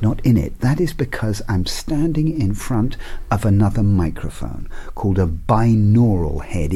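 usual speaking voice

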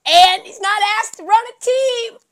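normal talking voice

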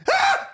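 very high startled shriek; mock scream sliding into laughter; imitated scream. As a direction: mimic a sudden piercing yelp. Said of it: "until she yelled".

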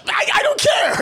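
very high, laughing exasperation; high-pitched mock protest, breaking into laughter; exasperated outburst. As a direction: squeal it in comic exasperation. Comic outburst at pretentious gushing.

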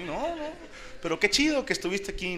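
high, soft and patronizing; mock condescension. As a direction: brush him off politely. Imita a excompañeros, condescendiente. (condescendencia burlona, aguda y suave)

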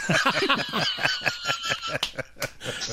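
high-pitched laugh. Real, not acted. Big laugh at joke.

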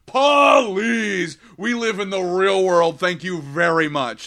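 high, loud dismissive shout; aunt brushing off prayer with a mocking shout; mock scoffing aunt. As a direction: scoff like a smug skeptic.

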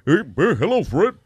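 a hint of a goofy cartoon neighbor; Barney Rubble voice. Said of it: "Hablaba así". (voz de caricatura algo más aguda)

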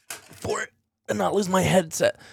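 straining while leaning over; strained, reaching for parts; effort grunt. Real, not acted. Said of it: "Sorry for the grunting and groaning".

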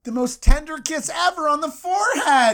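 high, loud, laughing reveal; giggling twist; laughing punchline. A narrator's reading. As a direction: burst out with the surprise.

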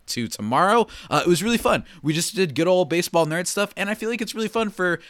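usual speaking voice

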